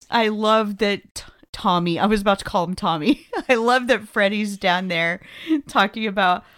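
usual speaking voice

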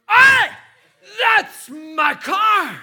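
loud, high yell, stretched slow; shouting. Retold or imitated. Yelling at car thieves, full-throated shout. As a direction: bellow it at the thieves. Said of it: "I shouted".

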